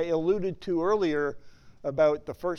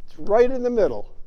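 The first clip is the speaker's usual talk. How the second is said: emphatic repeat; 4 semitones higher, 7 dB louder than usual. Shown as a demonstration.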